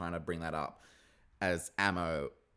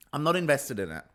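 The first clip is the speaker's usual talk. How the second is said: ordinary talk, nothing marked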